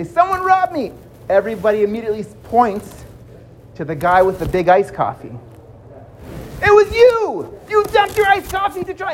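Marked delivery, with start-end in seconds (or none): 0.0-0.9 high distressed call; robbed man's cry. Acted. Victim repeats cry. 6.6-9.1 angry accusation. Acted. Crowd accuses coffee guy.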